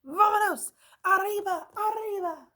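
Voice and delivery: hammed-up Spanish battle cry; Spanish-accented cheer. A narrator's reading. Chinchetta cheers.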